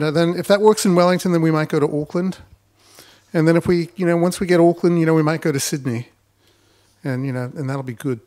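broad Kiwi accent, mock-naive entrepreneur; hammed-up Kiwi founder voice. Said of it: "I really like your Kiwi accent there".

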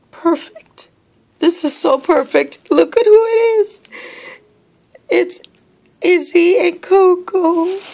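high-pitched, moved gushing; high and overcome with delight; overwhelmed delight. Real, not acted. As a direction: gush in a high voice, touched by the gift. Overwhelmed by the gift.

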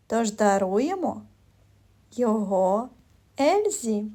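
normal talking voice

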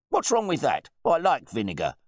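loud, high-pitched outrage; blustering, affronted wine bore; indignant host. A narrator's reading. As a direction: challenge the butler in disbelief. Host retorts to butler.

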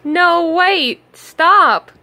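high, loud call to stop; pleading shout. A narrator's reading. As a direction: call out desperately. Ruff begs mouse to stay.

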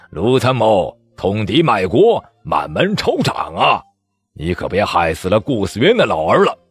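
loud, mocking warning; an official mocking an officer with a threat; sneering threat. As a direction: threaten him with a cold sneer. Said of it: "冷笑".

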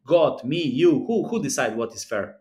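ordinary talk

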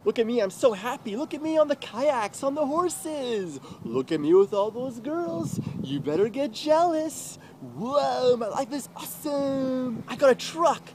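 high, gushing mock bragging; mocking show-off. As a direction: parody someone flaunting a perfect happy life. Mocks ex showing off happy life.

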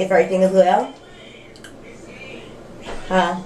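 biting on a chewie; speaking around teeth aligners; talking with aligners in. Real, not acted. Seen 0: Fits aligners at start, later bites green chewie while talking.

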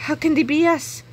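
ordinary delivery, nothing marked

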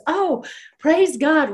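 high, loud, drawn-out retold rejoicing; acted-out cry of thankful relief; exultant relief. Retold joyful relief at finding her.

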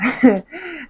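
short high laugh at her own misery; a quick, rueful burst of laughter. Real, not acted. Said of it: "I'm laughing now".

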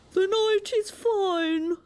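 high, slow storybook walrus oration; grand walrus. A narrator's reading. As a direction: announce it like a pompous walrus. Walrus speaks.

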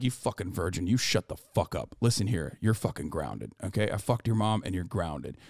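low, soft mock-stern scolding; quietly menacing fake-dad telling-off; mock losing it. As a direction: retell the tirade in a deep hushed voice. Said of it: "I lost my shit".

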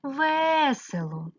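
весело with a long, stressed first е; весело drawn out on its first syllable; stressed check word. Shown as a demonstration. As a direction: stretch ВЕ at the start of весело. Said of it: "Підбираємо слово".

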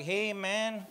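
a lightly put-on Mexican accent, taunting and slow; Mexican accent virus. Comic voicing the virus taunting America in a mock Mexican accent. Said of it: "this virus ain't got a Mexican accent".

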